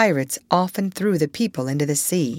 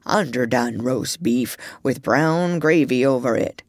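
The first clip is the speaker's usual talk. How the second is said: sleepy mumble; 6 semitones lower than usual. A narrator's reading. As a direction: mumble it from deep in a dream. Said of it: "he mumbled".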